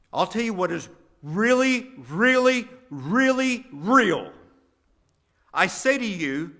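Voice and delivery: loud, high, thundering sermon delivery; booming, emphatic preacher raising the pitch; full-force preaching. Acted. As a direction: proclaim it to the whole church at full voice. Preaches Jesus' words at full force.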